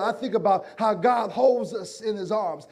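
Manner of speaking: usual speaking voice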